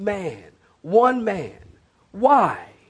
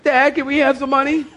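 put-on higher pleading kid voice; child asking dad; 7 semitones higher than usual. Child asks dad for money, higher pleading kid voice. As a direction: beg like a little kid.

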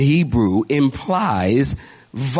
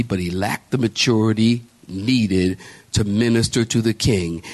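ordinary talk

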